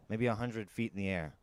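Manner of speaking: usual speaking voice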